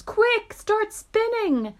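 very high, loud, stretched-out order; high-pitched shouted plea to hurry; urgent yell. Acted. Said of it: "yelled James".

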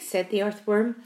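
ordinary delivery

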